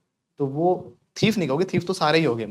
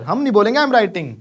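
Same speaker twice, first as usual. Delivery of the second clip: mock stubborn student; 6 semitones higher, 8 dB louder than usual. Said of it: "नहीं सुधरेंगे sir".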